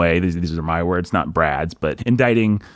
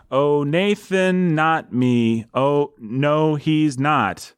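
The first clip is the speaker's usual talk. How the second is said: slow, drawn-out verse line with a higher start; metered recitation; 4 semitones higher, much slower than usual. Pretend. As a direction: recite it like solemn mock poetry. Composing names into metered verse.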